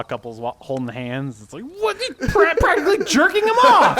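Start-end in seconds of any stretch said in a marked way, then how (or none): 1.8-4.0 high, loud, laughing outrage as a prudish bully; a high mock-shocked yelp breaking into laughter; scandalized bully. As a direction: shriek it like a shocked small-town bully.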